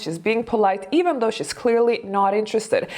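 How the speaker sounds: ordinary delivery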